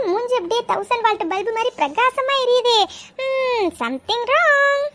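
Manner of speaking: long hum, then drawn-out teasing words; knowing tease. Acted. Friend catches the giggle over Romeo, slow teasing suspicion. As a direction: stretch it out suspiciously, very slowly.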